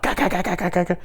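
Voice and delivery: high rattling ka-ka-ka of a transmission; imitated car noise. Storyteller mimicking the slammed transmission, high rattling noise. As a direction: mimic a grinding gear in rapid, high staccato. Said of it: "really loud sound".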